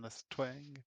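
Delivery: normal talking voice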